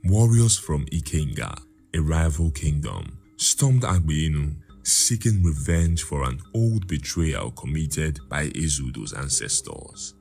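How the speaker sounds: ordinary delivery, nothing marked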